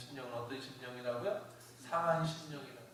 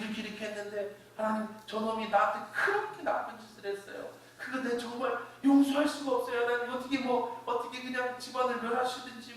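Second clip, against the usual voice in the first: higher-pitched plea, climbing as the grievance grows; complaining prayer; 5 semitones higher than usual. Acted. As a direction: pour out the complaint to God, pitch rising. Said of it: "하나님 앞에서 불평하라는 거예요".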